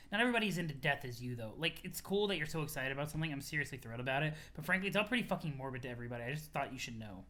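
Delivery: low, soft, quick delivery; level with them, low and quiet; subdued frank friend. Acted. Friend telling Aradia it's morbid, low frank voice. Character continues gently checking Aradia.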